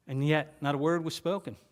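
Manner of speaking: normal talking voice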